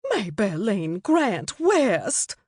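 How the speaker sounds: slow, drawn-out scandalised use of the full name; a mother scolding in shock; gasped full name. A narrator's reading. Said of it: "she gasped".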